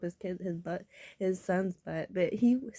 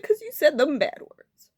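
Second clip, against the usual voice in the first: loud, raised-pitch telling-off; retold scolding; 6 semitones higher, 12 dB louder than usual. Man scolding the speaker for bad words, imitated telling-off.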